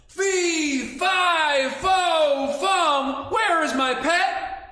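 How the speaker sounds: loud, high, drawn-out giant chant; hammed-up storybook giant roar; giant's shout. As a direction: bellow it out slowly for the whole room. Giant shouts curse and question.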